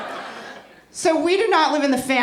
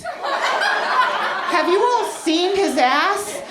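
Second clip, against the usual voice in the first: giddy lust; 8 semitones higher, slower than usual. Real, not acted. Lusting over his ass.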